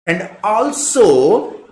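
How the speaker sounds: teacher drawing out a thought; drawn-out also. Real, not acted. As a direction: hold "also" out while finding the next point. Teacher stretching a connective.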